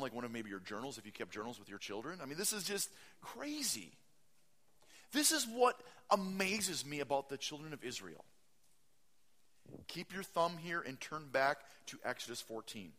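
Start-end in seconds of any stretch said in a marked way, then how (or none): none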